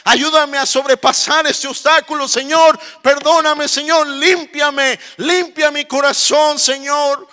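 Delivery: high, impassioned begging to God; acted desperate prayer. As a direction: plead urgently as a repentant believer, fading at the end. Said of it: "Diciéndole".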